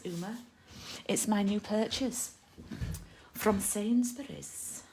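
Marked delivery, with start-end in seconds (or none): 1.1-4.8 mock-posh, slightly higher and louder. Vlogger telling viewers about a new purchase, jokey posh voice. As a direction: put on posh airs, drawing out the last word. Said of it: "said it in a posh voice".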